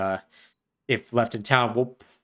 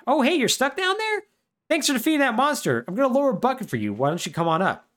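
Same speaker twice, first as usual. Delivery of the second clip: high, chirpy voice calling down, fast at the end; cheery rescuer; 12 semitones higher, faster than usual. Retold or imitated. Rescuer calls down to the boy in the sewer, high chirpy voice. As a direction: call down friendly from above.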